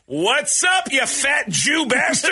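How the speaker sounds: high, slow, yelled taunt down the phone; mock shouted insult. The caller yelling a slow taunt down the phone. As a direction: bark the insult like a prank caller.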